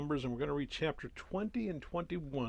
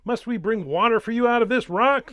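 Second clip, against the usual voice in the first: very loud, high furious rebuke; Moses rebuking; 8 semitones higher, 14 dB louder than usual. A narrator's reading. Moses shouting at the rebellious people before the rock, furious. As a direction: thunder it at the rebels. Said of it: "and he said to them".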